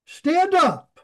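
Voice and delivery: high, loud commanding call; exclamation-point command. Acted. God shouting at Joshua lying face down, loud commanding call. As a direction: bark God's order to get up. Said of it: "many translations with an exclamation point".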